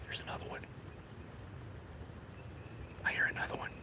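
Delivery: hushed whisper listening for deer; barely voiced whisper. Real, not acted. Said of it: "That's why I'm talking low".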